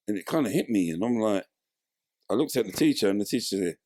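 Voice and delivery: ordinary delivery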